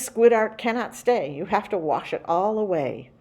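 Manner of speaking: ordinary delivery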